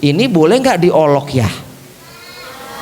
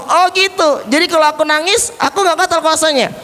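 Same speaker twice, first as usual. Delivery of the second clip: high, quick puppet voice; high-pitched character voice; puppet Ali, questioning; 10 semitones higher, faster than usual. Pretend. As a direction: ask as a little puppet who just understood. Puppet asks about fasting.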